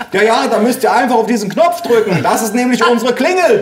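high, exasperated yelling; bellowing into receiver. A narrator's reading. Mother explaining the doorbell by phone, bellowing. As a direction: yell instructions at someone hard of hearing. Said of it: "brüllte meine Mutter in den Hörer".

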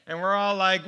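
ordinary delivery

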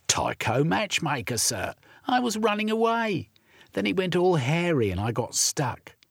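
put-on a poor runaway boy answering politely; street urchin boy.

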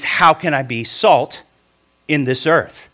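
ordinary talk, nothing marked

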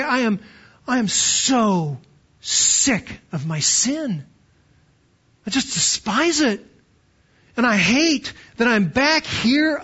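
slow, emphatic retold frustration with oneself; anguished confession. Church member confessing to the pastor, sick of sin, exasperated. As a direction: draw out the key words in weary self-disgust.

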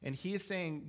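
ordinary talk

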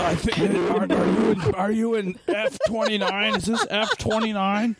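high, strained mock stage whisper; hammed-up seat query. Moviegoer checking seat numbers, hammed-up high hush. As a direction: overplay a hushed, fussy question.

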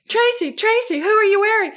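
high, loud mock reporter calling out; imitating an eager red-carpet interviewer. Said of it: "whoever's videoing it is faking".

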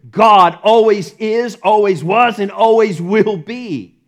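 loud, slow, building proclamation; full-voiced preacher declaration; preaching at full force. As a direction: thunder it out to the congregation.

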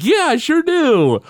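high, loud, slow mock Homer; Homer boasting. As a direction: stretch it out in a squeaky, cocky voice. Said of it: "And Homer's like".

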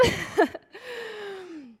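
high laugh, trailing down. Real, not acted. Preacher joking about broken tear ducts, high laughter.

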